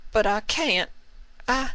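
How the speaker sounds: put-on high young mare, words failing; high voice trailing off in despair; distraught young mare; Applejack breaking down.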